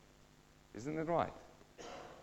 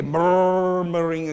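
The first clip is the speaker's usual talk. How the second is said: loud, slow "MURRR-muring"; murmuring noise; 6 dB louder, much slower than usual. Shown as a demonstration. Preacher imitating Israelites grumbling, drawn-out rumble. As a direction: roll out the first syllable of "murmuring" as a rumble. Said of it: "I can hear it there".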